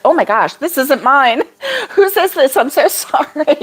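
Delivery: imitating a flustered apology through laughter; retold laughing apology. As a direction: break into giggles while quoting her. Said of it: "She laughed".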